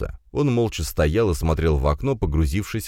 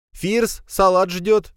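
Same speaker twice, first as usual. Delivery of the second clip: high-pitched call to a son; mother calling; 3 dB louder, much slower than usual. A narrator's reading. Mother calls Firs to the salad, calling out. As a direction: call out as an impatient-to-serve mother. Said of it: "снова раздался голос матери".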